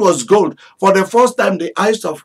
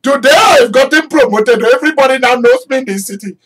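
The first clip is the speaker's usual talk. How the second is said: high and loud, bursting with joy; excited Mordecai; 8 semitones higher, 5 dB louder than usual. Acted. Mordecai boasts to the city about his signet ring, overjoyed. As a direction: crow about being a prince. Mordecai celebrating promotion, acted.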